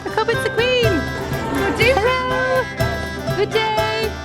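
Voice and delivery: high cheery call to the Queen; excited greeting. Real, not acted. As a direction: call out a bright hello.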